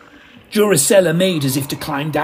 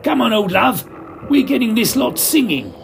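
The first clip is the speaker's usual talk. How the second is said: shouted encouragement; 8 semitones higher than usual. Acted. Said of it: "shouted into his befuddled ear".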